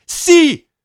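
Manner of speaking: put-on high, loud defiant child; boy talking back loudly; retort. A narrator's reading. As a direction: fire it back. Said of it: "rétorqua Anjo".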